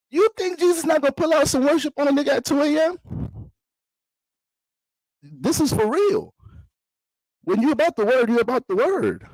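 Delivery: high, far-off preacher climax; climactic preaching. Pretend. Comedian preaching to his livestream, high climactic call. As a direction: preach it to the rafters.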